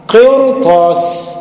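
قرطاس drawn out, ر gliding straight into the ط; very slow demo. Shown as a demonstration. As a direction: stretch قرطاس with ر and ط in one sweep. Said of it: "ادي الراء كده وقربها للطاء".

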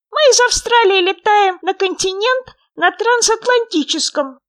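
high, loud, squeaky little frog; small creature's high, loud voice. A narrator's reading. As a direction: pipe up eagerly as a tiny frog. Frog boasts to the friends.

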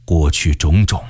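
low, slow and weighty; heavy, grave tone. A narrator's reading. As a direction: pronounce a final break with the past, slowly and gravely. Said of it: "语气沉重地说".

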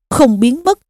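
ordinary delivery

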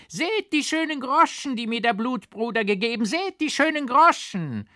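very high, loud crone cheer; jubilant hag. A narrator's reading. Hag rejoices over the coins, jubilant crone shriek. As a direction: shriek with mad joy. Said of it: "jubelnd und jauchzend".

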